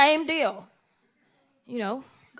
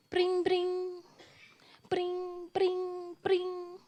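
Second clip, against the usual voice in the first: phone ring imitation; 6 semitones higher, 3 dB quieter, much slower than usual.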